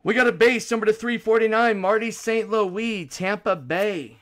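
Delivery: loud, high, punchy card call; card break announcer. Real, not acted. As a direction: announce the pull with big energy. Calls out cards live.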